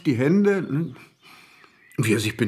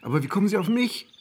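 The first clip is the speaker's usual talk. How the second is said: higher, frantic denial; higher, rattled protest of innocence; panicked protest; 7 semitones higher than usual. Acted.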